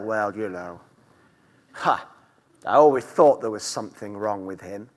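lightly mock a self-righteous gossip, hushed; smug gossip. Gossiping churchgoer mocked in sermon.

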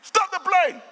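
high-pitched, insistent call; retold demand. Passenger demanding the plane be stopped, high insistent call. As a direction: re-enact an urgent order to halt.

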